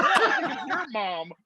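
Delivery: high, laughing comeback; laughing retort. Retold or imitated. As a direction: toss the retort back through laughter. Joking retort in retold talk.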